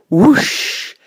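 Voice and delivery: high rushing rocket noise; storybook take-off sound; whoosh. A narrator's reading.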